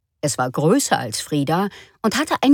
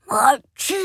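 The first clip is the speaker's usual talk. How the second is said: high sneeze sound; 7 semitones higher than usual. Acted. The antlered animal sneezing at Frieda's door. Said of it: "machte das Geweihtier".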